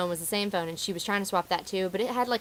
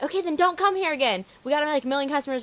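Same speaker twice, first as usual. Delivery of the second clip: higher-pitched dismissive comeback; mock retort; 7 semitones higher than usual. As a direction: play at telling a customer off. Imagined retort to rude customer.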